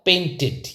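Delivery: "पेंटेड" said PAIN-tid, short i ending; teacher's careful model of "पेंटेड" with -id; correct pronunciation. Shown as a demonstration. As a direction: end "पेंटेड" in -tid, as in lid. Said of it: "क्या हो जाएगा इसका".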